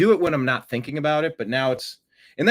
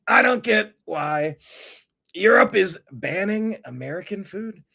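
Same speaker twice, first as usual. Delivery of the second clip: mock Trump voice; 5 semitones higher than usual. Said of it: "He's like".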